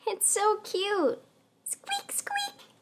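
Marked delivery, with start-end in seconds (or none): 1.6-2.7 very high, soft mouse squeaks; very high quiet squeak, squeak. Shown as a demonstration. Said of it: "Can you squeak like a mouse?".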